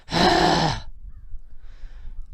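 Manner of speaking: long, heavy huff; exasperated sigh. Pretend. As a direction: let out an imagined put-upon sigh. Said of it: "being all mad and frustrated".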